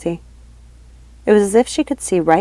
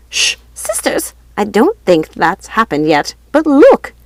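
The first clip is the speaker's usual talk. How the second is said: whispered hush, then a loud high look; urgent witch voice; 4 semitones higher, 5 dB louder than usual. A narrator's reading. Lucinda hushing then urgent.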